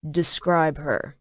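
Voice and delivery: low, slow and quiet menace; a touch of dark threat, slow and low; ominous husband demanding a description, low and slow. A narrator's reading. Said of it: "His voice turned ominous".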